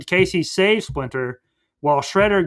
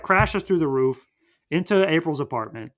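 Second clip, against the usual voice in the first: normal talking voice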